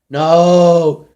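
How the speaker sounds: sharp, loud exasperated no; correcting a confused friend, loud exasperated no. Retold or imitated. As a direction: shut the misunderstanding down hard. Correcting again.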